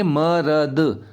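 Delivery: म, र, द as three separate sounds; letter by letter. Shown as a demonstration. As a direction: sound out म, र, द slowly, each alone. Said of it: "अगर हम ऐसे लिखेंगे तो".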